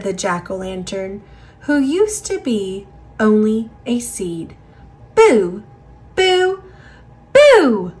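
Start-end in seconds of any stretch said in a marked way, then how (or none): 5.1-7.9 bright shouted boo, louder; playful loud boo. Acted. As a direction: jump out with a boo. Final triumphant boos.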